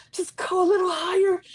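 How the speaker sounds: high, whimpering retold plea; mock-tearful urging; pleading coaxing. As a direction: coax it upward in a small high voice. Re-enacting coaxing the flame.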